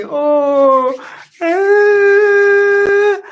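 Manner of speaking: very high, loud fake wail; mock crying wail. Asked by Davy to cry for him, a mock wail. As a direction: ham up a crying sound on request. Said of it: "cry for me".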